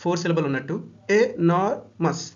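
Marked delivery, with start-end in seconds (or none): 1.2-2.2 enormous stretched very slowly, syllable by syllable; slow syllable count. Shown as a demonstration. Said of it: "three times vowel sound".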